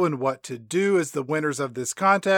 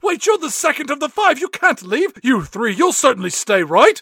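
high, hammed-up pleading boss; mock pleading; 6 semitones higher than usual. [name] to the remaining Spice Girls, high mock pleading. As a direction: plead desperately, overdone.

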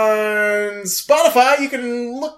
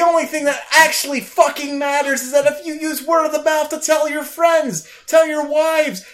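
escalating shout; 6 semitones higher than usual. Acted. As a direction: yell it like he's losing control. Spiraling into shouted plea.

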